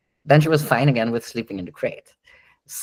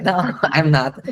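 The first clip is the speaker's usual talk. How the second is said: higher mock protest, broken by a laugh; playful, pitched-up refusal; protesting dog; 4 semitones higher than usual. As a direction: voice the dog's objection through a laugh. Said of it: "protest kind of way".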